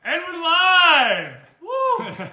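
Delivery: big stretched-out show opener; excited announcer. Acted. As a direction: hype up the start of the show. Opening the live show.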